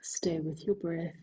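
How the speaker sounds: breathy whisper, no voice. Acted. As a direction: whisper the cue gently. Soft breath cue in meditation.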